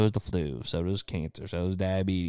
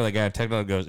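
ordinary delivery

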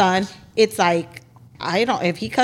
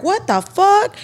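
a high, louder startled cry; shocked kid; 10 semitones higher, 4 dB louder than usual. Retold or imitated. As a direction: imitate a kid shocked by what's on screen. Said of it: "they're like".

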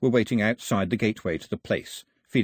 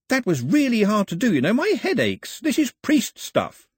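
much higher, hesitant fumbling translation; bluffing his way through the song; flustered Pilu; 13 semitones higher, 4 dB louder than usual. Acted. Pilu complains after effort.

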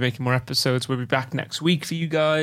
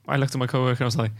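ordinary talk, nothing marked

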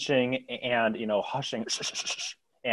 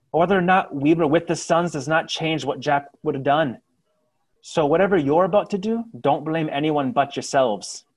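defiant retort; 5 semitones higher, 9 dB louder than usual. Acted. Woman retorts to angry civilians.

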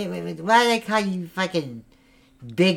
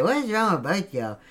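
ordinary delivery

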